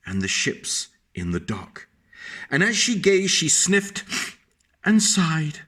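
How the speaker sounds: put-on lilting read-aloud voice for a child; sing-song picture-book reading; storybook narration. A narrator's reading.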